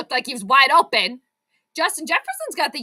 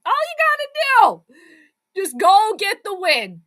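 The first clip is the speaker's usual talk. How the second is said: high, loud mock-sing-song, slowing down; mock how easy it should have been; sarcastic exasperation; 7 semitones higher, 7 dB louder than usual. Real, not acted. Streamer mocking the team for blowing an easy win, high and sarcastic.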